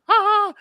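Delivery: very high, loud taunting "ha ha"; gleeful cackle. Acted. Bacchae springing out in front of the thief, high taunting cackle. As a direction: cackle as you pounce on your prey.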